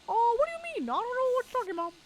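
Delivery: high, fast put-on innocent voice; high squeaky feigned innocence; mock innocent. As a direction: play dumb in a squeaky cartoon voice.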